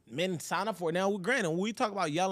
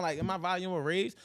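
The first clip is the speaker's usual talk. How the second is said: ordinary delivery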